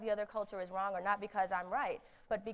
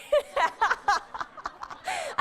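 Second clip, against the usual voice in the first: real, loud embarrassed laugh; self-mocking laugh; high burst of laughter; 10 dB louder than usual. Real, not acted. Laughs at own failed marriage.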